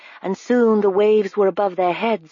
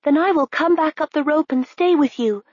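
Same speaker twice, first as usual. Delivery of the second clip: generous offer; 8 semitones higher than usual. A narrator's reading. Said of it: "Princess Nell said generously".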